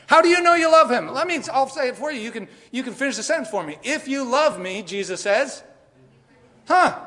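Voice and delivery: loud, high sermon peak; preaching at full force. Real, not acted. Pastor warning the congregation, loud and high. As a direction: drive the warning home to the church.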